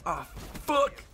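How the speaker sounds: high, loud "ah fuck"; frustrated yelp. Real, not acted. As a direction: yell it in frustration. Missed shot.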